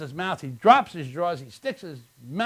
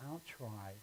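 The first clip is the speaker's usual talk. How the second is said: tiny, very soft, low offer; acted small, hushed voice; little voice; 7 semitones lower, 22 dB quieter than usual. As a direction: pipe up faintly from the back. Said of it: "a little voice from the back says".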